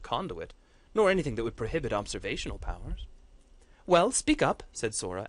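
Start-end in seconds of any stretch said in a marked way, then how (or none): 3.9-4.6 sharp, higher and louder, impatient demand. Acted. Matriarch pressing a hesitant seer, impatient and loud. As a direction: push him to answer, edge of impatience. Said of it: "an edge of impatience creeping into her voice".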